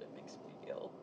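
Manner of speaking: hushed, soft whisper close to her; soft soothing whisper. Acted.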